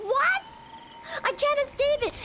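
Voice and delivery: high-pitched mock-distraught outcry; exasperated. Telling how housemates sang it too, exasperated mock outcry. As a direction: retell it as a hammed-up high wail of disbelief.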